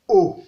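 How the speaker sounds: Haut short, dropping low. Shown as a demonstration. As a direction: drop Haut briefly to the bottom. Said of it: "Ça donne ceci". (« Haut » au ton bas)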